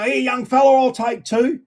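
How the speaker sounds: a hint of a cheery pub bloke calling to the kid; publican buying rabbits. Publican calling to the boy selling rabbits, lightly voiced. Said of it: "And they go".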